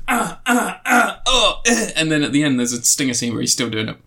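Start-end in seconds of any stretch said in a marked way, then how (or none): 0.1-1.9 hammed-up pained cries.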